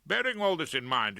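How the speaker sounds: ordinary delivery, nothing marked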